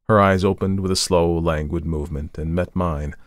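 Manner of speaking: plain reading voice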